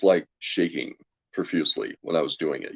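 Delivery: normal talking voice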